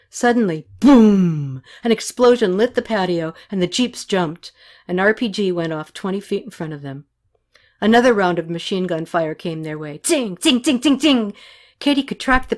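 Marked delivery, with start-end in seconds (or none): 0.8-1.6 loud, booming burst; voiced explosion; imitated explosion. A narrator's reading. 10.0-11.3 high, ringing tings; pitched-up voiced ricochets; imitated bullet hits. A narrator's reading.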